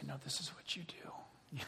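an unvoiced whisper, coaching a student; whispered instruction. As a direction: hush it like a secret lesson. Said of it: "she would say".

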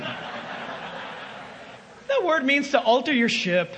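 normal talking voice

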